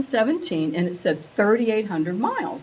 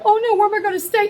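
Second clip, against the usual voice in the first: high, fast, rushed panic; retold panic; 13 semitones higher, 5 dB louder, much faster than usual. Mom panicking with no hotels, high and rushed. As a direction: scramble like you're stranded. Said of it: "I panic".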